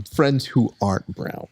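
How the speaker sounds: ordinary talk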